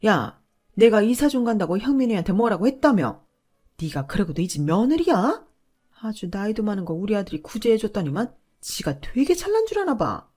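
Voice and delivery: loud scolding mother-in-law; mother-in-law scolding in public, loud and booming. Retold or imitated. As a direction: berate her for everyone to hear. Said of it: "쩌렁쩌렁한 목소리로".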